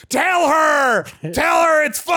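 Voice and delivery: high-pitched loud frantic scream. A narrator's reading. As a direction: scream an order across the cabin. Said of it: "looked at me and screamed".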